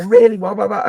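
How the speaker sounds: high, loud mock disbelief; surprised. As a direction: sound stunned by the news. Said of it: "we go".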